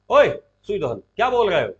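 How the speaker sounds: loud, sudden acted rebuke; short burst of reprimand; Krishna, sharp scolding. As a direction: cut him off with a loud challenge. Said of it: "बहुत तेज डांटा".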